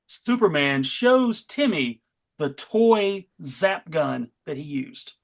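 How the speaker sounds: normal talking voice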